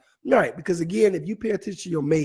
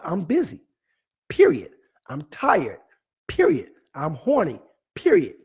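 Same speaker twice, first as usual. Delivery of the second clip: each "period" snapped out high and loud; mock-firm declaration; 4 semitones higher, 4 dB louder than usual. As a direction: lay down the law, no explanation owed. Emphatic list, each ended "period.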